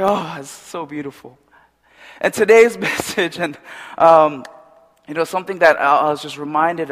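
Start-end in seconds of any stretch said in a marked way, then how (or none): 0.0-1.4 soft, hushed wonder; tender awe. Retold or imitated. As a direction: sigh it out, deeply moved. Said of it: "I was just like".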